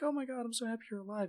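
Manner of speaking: high, fast, breathless mock joy; gushing Isabel. Isabel greeting the resurrected king, high gushing mock joy. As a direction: squeal it like an overjoyed girl. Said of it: "Isabel's like".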